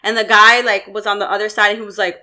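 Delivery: ordinary talk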